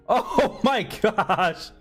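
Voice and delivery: slow drawn-out laughing gasp; stretched, laughing oh my gosh. Real, not acted. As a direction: laugh through it at the mishap. Laughing at mishap.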